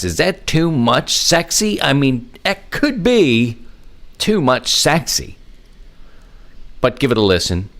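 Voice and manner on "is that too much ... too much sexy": high, slow, drawn-out mock coyness; playfully teasing. Host asking whether the lineup is too much sexy, playfully teasing. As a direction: stretch it out high, hamming up the question.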